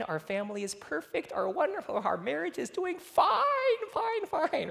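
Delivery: high forced "fine, fine"; mimicking a family faking all is well, high and forced; mock cheerful pretending. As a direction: sing the fake reassurance. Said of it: "pretending everything is fine".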